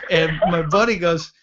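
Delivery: ordinary delivery, nothing marked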